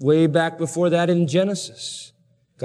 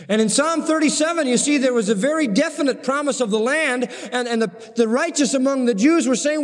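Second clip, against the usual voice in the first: high, ringing pulpit voice; soaring sermon delivery; preaching at full force; 10 semitones higher than usual. Acted. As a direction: drive the promise home to the congregation. Preacher rising again.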